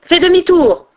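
high, loud order; commanding. A narrator's reading. Unseen voice turning Odin back, commanding. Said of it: "ordonna encore la voix".

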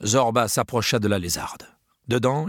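plain reading voice

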